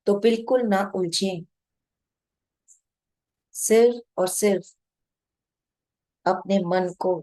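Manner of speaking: measured meditation guide, long pauses between short phrases; guided meditation. Real, not acted. Guide to meditators watching their breath, calm guided meditation. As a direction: guide them unhurriedly, phrase by phrase, leaving long silences.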